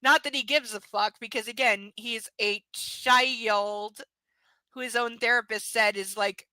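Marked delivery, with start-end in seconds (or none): 2.0-4.0 drawn-out scorn. Real, not acted. Mocking the character.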